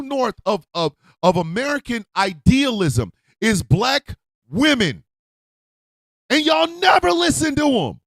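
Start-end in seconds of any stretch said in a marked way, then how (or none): 6.3-8.0 angry shout. Real, not acted. Angry rant at listeners.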